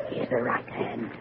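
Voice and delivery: breathy hush over the palm. Acted. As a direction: let it fall to a hush. Fortune teller reading palm.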